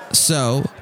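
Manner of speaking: plain reading voice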